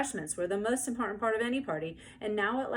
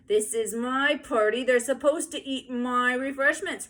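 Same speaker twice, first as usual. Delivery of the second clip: put-on loud, slow howling child; loud drawn-out tantrum wail; 5 semitones higher, 7 dB louder than usual. A narrator's reading. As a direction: howl it from the floor. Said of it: "Ramona howled".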